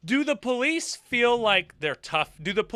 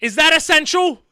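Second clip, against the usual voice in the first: high, loud insistent disbelief; incredulous; 8 semitones higher, 7 dB louder than usual. Real, not acted. Reactor spots a surfer behind the questioned sunbather, incredulous protest. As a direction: point it out urgently, voice raised. Pointing out surfer, disputing police logic.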